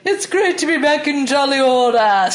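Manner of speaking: lightly put-on British accent for a jolly old England line; mock British accent. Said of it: "doing the British accent".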